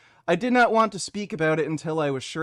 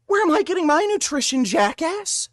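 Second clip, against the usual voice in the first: angry; 9 semitones higher, 4 dB louder than usual. Real, not acted. Ranting at an apology post.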